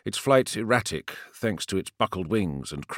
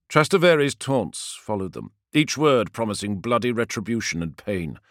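plain reading voice

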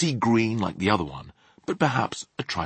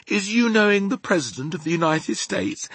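higher-pitched, deferential question; respectful; 9 semitones higher, 3 dB louder than usual. A narrator's reading. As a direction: ask with a touch of awe. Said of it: "in a rather respectful voice".

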